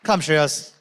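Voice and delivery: normal talking voice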